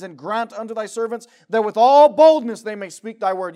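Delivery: loud, fervent reading of the plea; loud fervent prayer. Shown as a demonstration. Preacher reading the apostles' plea for boldness, loud and fervent. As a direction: cry out the verse for boldness. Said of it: "same verse said soft after".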